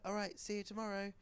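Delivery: put-on high, cheerful child character; cartoon puppy Bluey. Young pup saying goodbye to a friend, high and breezy. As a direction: pitch it up like a carefree kid. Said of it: "Bluey's like".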